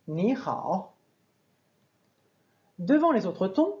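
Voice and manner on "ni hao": "Ni" rising only, then a dipping "hao"; sandhi du troisième ton. Shown as a demonstration. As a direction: lift "Ni" without dipping, let "hao" dip. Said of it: "je suis juste monté". (« Ni » juste montant, puis « hao » au troisième ton)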